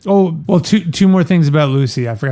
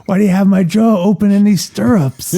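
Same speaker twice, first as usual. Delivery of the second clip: whiny patient voice; 6 semitones higher than usual. Acted. Voicing Fontaine at the doctor.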